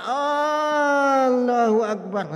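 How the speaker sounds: long, loud, drawn-out takbir; hammed-up dragging prayer call; groggy, worn out. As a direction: imitate a dazed worshipper sinking heavily into prostration. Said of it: "Udah teler, Pak".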